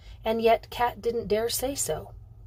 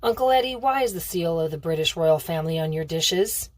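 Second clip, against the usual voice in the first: usual speaking voice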